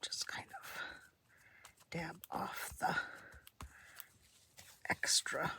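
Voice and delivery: breathy whisper while crafting. Real, not acted.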